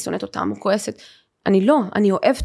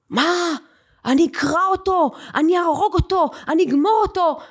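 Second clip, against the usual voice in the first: father's outburst, higher and raised throughout; furious father; 8 semitones higher than usual. Retold or imitated. Father hears his daughter was harassed and shouts furious threats. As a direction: explode with protective rage.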